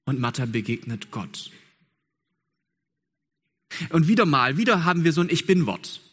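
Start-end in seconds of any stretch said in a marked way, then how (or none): 3.9-6.0 louder and higher, driving the point home; rising preacher's fervour; preaching at full force. Acted. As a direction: wieder punched hard like a pulpit call. Emphatic preaching build.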